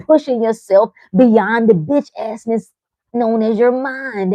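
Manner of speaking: ordinary delivery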